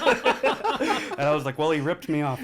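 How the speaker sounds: laughing through it. Real, not acted. Retelling the call with his mom, laughing. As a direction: still laughing as he answers.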